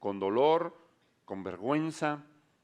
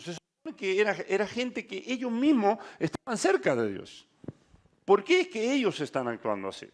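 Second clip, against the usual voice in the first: higher and louder, rising to an insistent peak; building preaching; 6 semitones higher, 4 dB louder than usual. Acted. Preacher challenges the congregation, rising fervent preaching. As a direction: press the point harder and harder.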